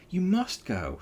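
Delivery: high, loud urgent plea; urgent cry. A narrator's reading. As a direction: urge him on, insistent. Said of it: "Sally cried".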